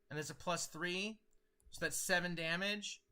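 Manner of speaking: usual speaking voice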